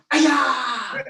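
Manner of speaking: loud, high martial arts kiai; sharp karate-class battle cry; loud yell. Shown as a demonstration. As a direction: yell it out high, falling away at the end. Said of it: "Loud yell".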